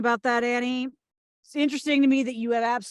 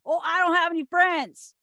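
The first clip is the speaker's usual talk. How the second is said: mock whine; 6 semitones higher than usual. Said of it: "went home crying to my mommy".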